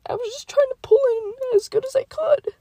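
acted-out defensive squeal of an explanation; high-pitched excuse. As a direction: explain yourself in a high, strained little voice. High-pitched self-defence in retold scene.

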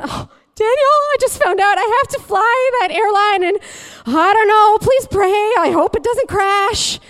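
loud, high-pitched mock panic; melodramatic, frantic begging; panicked plea. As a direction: wail it to your husband, hammed-up dread. Acting out her panicked text.

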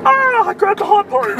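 high pained yelp. Real, not acted. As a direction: yelp like you got burned. Burned hand on the hot part.

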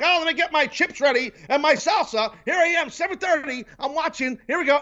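high, eager mock sucker voice; jabroni mark. Host mocks himself waiting for the game, high gullible-fan voice. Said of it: "So me, like a jabroni mark".

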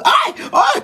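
high loud surprised squeal; a sharp startled squeal. Real, not acted. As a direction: shriek out in sudden shock. Sudden shriek at comment.

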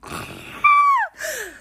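long high-pitched squeal; wordless high squeal of being overwhelmed. Real, not acted. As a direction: let out a sustained, very high fangirl noise.

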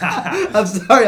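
slow, loud, laughing; laughing apology. Real, not acted. Co-host apologizing for laughing hard, giggling. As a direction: apologize while still cracking up. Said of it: "I'm laughing really hard".

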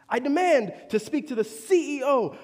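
mock demanding customer. Preacher mimicking guest demanding the CEO, mock outrage. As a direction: lean hard on demand. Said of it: "He goes".

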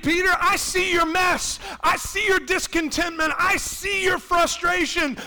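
loud, high, ringing reassurance; fervent preaching shout; bold Jesus. Pretend. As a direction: preach it like Jesus calling Peter. Preacher voicing Jesus to Peter.

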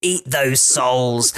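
slow, tempting villain voice; drawn-out devilish urging; evil inner voice. Retold or imitated. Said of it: "the evil voice in the back of my head".

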